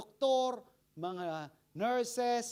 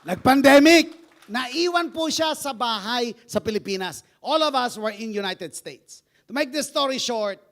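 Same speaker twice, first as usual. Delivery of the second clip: preaching loudly; 3 semitones higher, 14 dB louder than usual. Real, not acted. Preacher raising his voice.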